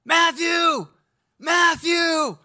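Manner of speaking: frantic calling. Retold or imitated. Father searching the beach, calling desperately for his son. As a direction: call out for a lost boy, rising in panic. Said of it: "He's like".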